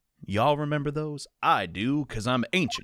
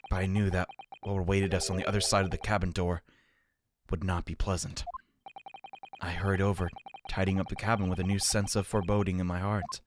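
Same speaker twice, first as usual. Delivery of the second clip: low, measured narrator; story narration; 6 semitones lower, 4 dB quieter, faster than usual. A narrator's reading. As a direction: read it as inner narration, pitched down. Reads the game's narration.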